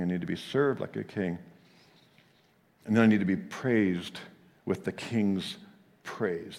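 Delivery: normal talking voice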